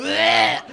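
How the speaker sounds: loud disgusted bleh retch; mock retch. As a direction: gag like the sauce is on you. Retching at sauce on hand.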